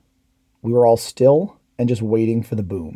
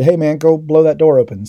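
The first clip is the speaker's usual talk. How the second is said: raised, higher call across the squad; called-out order; 5 semitones higher, 6 dB louder than usual. Retold or imitated. Squad leader ordering the assaultman to blow the door, raised call.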